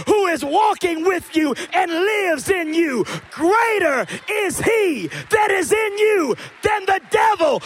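loud, high preaching climax; full-throated preacher rising to hallelujah; preaching at full force. Acted. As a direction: lift the room like a preacher.

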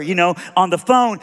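usual speaking voice

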